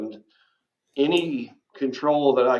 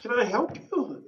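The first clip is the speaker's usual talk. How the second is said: a caught-off-guard, mock-polite question; startled reply; 12 semitones higher, 3 dB louder than usual. Retelling his own startled reply.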